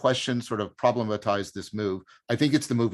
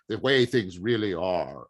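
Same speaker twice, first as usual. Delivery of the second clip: slow, lowered, sonorous pronouncement; deep, sonorous voice; 4 semitones lower, slower than usual. Shown as a demonstration. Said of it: "have to say it with a deep, sonorous voice".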